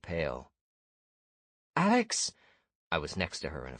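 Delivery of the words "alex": sharp high alarmed call; alarmed cry. A narrator's reading. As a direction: cry out in shock. Ania looks into the well.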